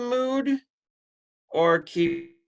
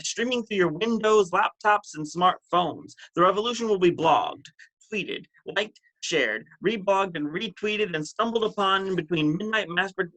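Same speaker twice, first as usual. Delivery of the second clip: rhythmic, incantatory poetry delivery; driving recital, leaning on each repeated phrase; spoken-word poem. Acted. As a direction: perform it as a slam poem with swinging pitch. Performs poem at open mic.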